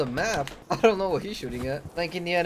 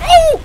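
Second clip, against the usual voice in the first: high loud yelp; startled yell; 14 dB louder than usual. Acted. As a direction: yelp when hit. Shot in game.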